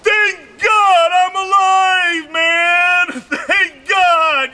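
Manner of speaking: hammed-up rider thankful to be alive; relieved cry. Girls off the bike, shouting high with relief. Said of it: "the only thing they got on their mind is".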